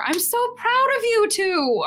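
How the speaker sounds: very high, gushing pride; proud, giggly mom. Acted. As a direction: coo it high to your two kids. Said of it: "super proud and giggly".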